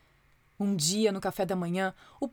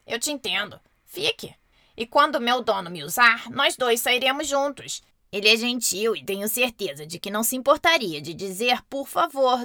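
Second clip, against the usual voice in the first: gently. Acted. A palavrinha forte acolhe a outra com gentileza. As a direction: welcome him kindly. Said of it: "disse gentilmente o outro por favor".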